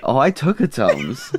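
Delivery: giggling through the words; laughing. Real, not acted. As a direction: laugh as you say it. Joking about Tums.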